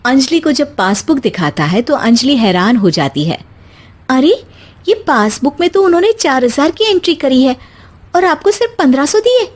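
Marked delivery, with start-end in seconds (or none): 4.1-9.5 high, fast astonished voice; wife stunned by the passbook; shocked wife. Acted. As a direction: gasp at the numbers. Anjali sees passbook fraud.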